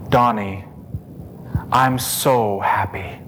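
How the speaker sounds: low, soft and slow, gently; tender whisper of a loving mother; hushed tender voice. Retold or imitated. A mother's voice telling her son she is happy, softly. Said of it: "most beautiful, feminine voice".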